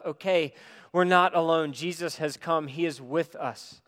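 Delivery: ordinary talk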